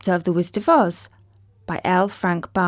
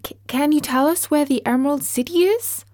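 put-on anxious young girl; 7 semitones higher, faster than usual. A narrator's reading. Dorothy pleads.

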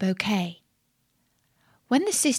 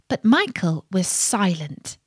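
ordinary delivery, nothing marked

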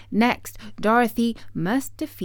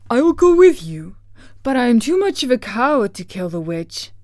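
loud, high lion voice, quickening; theatrical cowardly beast; 4 semitones higher, 8 dB louder, faster than usual. Acted. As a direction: declare it boldly, then rush the confession. Lion volunteers, admits cowardice.

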